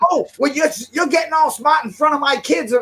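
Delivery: lightly mocked offended mother; mocking the woman. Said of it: "woman's reply retold".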